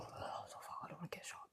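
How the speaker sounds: hushed, voiceless panicked aside; scared whisper. Retold or imitated. Guest scared shitless among armed men, frightened whisper. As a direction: whisper it like a frightened private thought.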